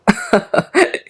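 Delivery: high giggle that escapes; involuntary laugh. Acted. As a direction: burst into a high-pitched acted laugh. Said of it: "وضحك كريم غصب عنه". (ضحكة غصب عنه)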